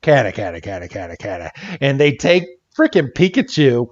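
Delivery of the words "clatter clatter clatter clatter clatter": low, soft, clipped alien clatter; a muttered, deep-pitched alien noise; alien chatter. Retold or imitated. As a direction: rattle it off like a gray space alien. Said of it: "these aliens run over and they're like".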